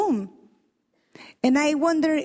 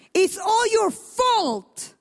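Sarai blaming; 6 semitones higher, much slower than usual. Acted. Sarai blames Abraham, read aloud.

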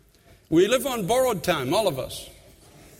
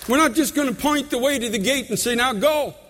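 preaching at full force; 7 semitones higher than usual. Acted. Pastor urging the church to mentor, high and ringing. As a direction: build the sermon to a high, ringing call.

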